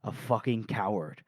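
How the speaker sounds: low and soft, spat out under the breath; low muttered insult. Retold or imitated. Prisoner sneering at her captor, low and quiet. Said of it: "And Shy goes".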